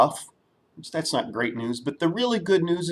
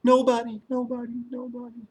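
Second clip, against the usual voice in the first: high, each nobody softer until it vanishes; fading echo; 6 semitones higher, 4 dB quieter, faster than usual. Acted. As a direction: let the word echo away into nothing. No answer echoes back.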